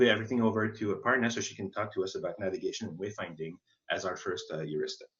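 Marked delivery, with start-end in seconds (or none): none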